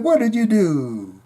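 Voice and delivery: [name]. Acted. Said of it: "I said".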